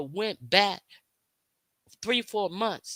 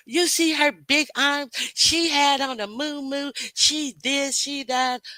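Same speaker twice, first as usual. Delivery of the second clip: high, louder, gasping mock gossip; 7 semitones higher, 4 dB louder than usual. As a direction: act out catty gossipers in shock. Mocking gossiping critics.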